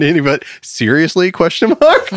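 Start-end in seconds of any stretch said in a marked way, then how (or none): 1.3-2.1 squeaks it way up high, half laughing; mock disbelief at a silly title; incredulous squeal. Real, not acted. Laughing at a video titled Is Gary DeMar a demon, high squeak.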